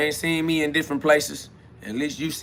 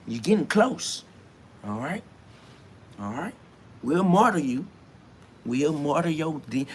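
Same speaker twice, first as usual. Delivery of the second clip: a quieter, hushed church elder threatening him; menacing church elder; 3 semitones lower, 8 dB quieter than usual. Acted. Church elder quietly threatens to martyr the preacher, hushed menace.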